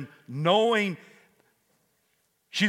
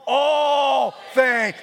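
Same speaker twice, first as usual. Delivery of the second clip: loud shouted all things, higher; with conviction; 8 semitones higher, 7 dB louder than usual. Shown as a demonstration. Congregation told to say it with conviction, shouted. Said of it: "say it with me with some kind of conviction".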